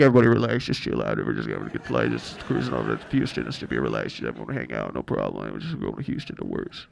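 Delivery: very low, soft laid-back murmur; mock a pilot who is far too relaxed; too calm pilot. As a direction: drop way down, quiet and casual. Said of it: "the pilot's too calm".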